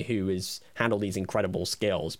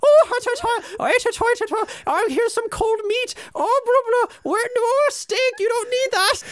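very high, loud shrieking parody; mock squeaky voice; 7 dB louder than usual. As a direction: screech it like a silly cartoon voice. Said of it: "my reaction is like this".

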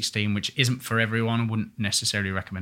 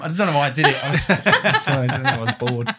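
laughing; 5 semitones higher, 5 dB louder than usual. Real, not acted. Laughing about the school play.

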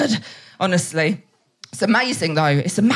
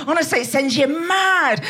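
ordinary delivery

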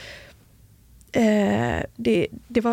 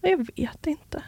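soft, higher, half-whispered answer; retold hushed reply; 6 semitones higher, 6 dB quieter than usual. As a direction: imitate a barely voiced, hesitant reply. Mammans tysta svar, återberättat.

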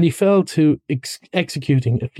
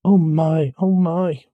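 high, mild lament; a touch of misery, higher pitched; miserable; 5 semitones higher, slower than usual. Acted. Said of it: "said the mole miserably".

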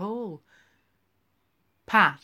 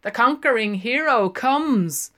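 loud, higher hail across the bar; hailing call; 5 semitones higher, 8 dB louder than usual. A narrator's reading. Linehan hailing Boylan as he strides in, loud. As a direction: call it out to greet him. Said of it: "hailed him".

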